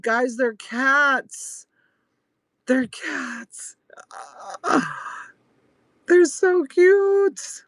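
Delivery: high, drawn-out squeal of delight; excited gushing. Real, not acted. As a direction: gush slowly over something adorable. Gushing over cat items on show.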